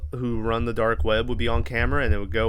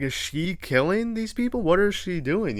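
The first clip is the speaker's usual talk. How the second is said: incredulous question; 6 semitones higher than usual. Retold or imitated. Narrator wondering about girl.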